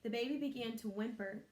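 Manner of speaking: ordinary delivery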